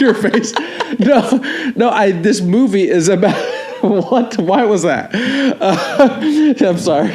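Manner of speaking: can't stop laughing while trying to talk. Real, not acted. Host cracks up at cohost's face, laughing.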